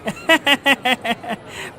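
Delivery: fawning chuckle. Acted. Fawning shopkeeper greets rich man.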